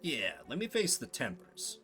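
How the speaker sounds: ordinary talk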